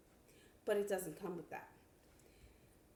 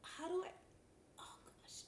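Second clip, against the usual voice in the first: soft unvoiced exasperated whisper; 9 semitones higher, 13 dB quieter than usual. Real, not acted. Squinting at tiny print, muttering to herself in a whisper. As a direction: breathe it out under your breath.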